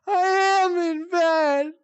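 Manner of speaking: high, slow, tearful mom voice; imitating crying mom. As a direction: whimper it as the sleepwalking mom. Voicing crying sleepwalking mom.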